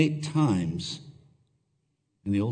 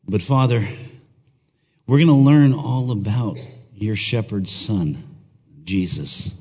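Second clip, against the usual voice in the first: earnest congregational prayer; leading prayer; 5 semitones lower than usual. Acted. Pastor praying over congregation before sermon, earnest prayer. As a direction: pray aloud for the church, heartfelt.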